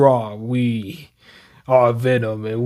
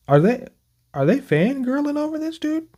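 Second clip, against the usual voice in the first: pitched way up, laughing in disbelief; amused disbelief at fangirling; high amused disbelief; 10 semitones higher than usual. Real, not acted. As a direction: react like you can't believe the fangirling.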